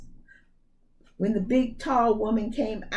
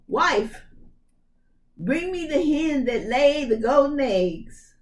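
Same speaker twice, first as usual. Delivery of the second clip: a commanding giant's call, pitched up; ogre's order; 6 semitones higher, 3 dB louder than usual. Acted. Ogre calling his wife to bring the hen. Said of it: "the ogre said".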